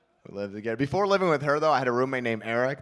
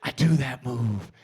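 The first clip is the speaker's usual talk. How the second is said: normal talking voice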